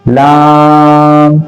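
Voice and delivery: लाम with the a held very long; elongated madd. Shown as a demonstration. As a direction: stretch लाम steadily on one note. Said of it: "इसको खींच कर पढ़ेंगे".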